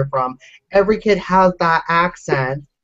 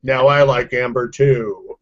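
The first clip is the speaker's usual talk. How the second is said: put-on deep voice; 6 semitones lower, slower than usual. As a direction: drop into a mock deep, low register. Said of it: "You could be low, can you?".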